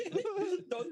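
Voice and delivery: high-pitched burst of laughter. Real, not acted. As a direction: crack up laughing. Laughing mid story.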